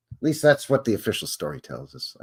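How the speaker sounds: ordinary delivery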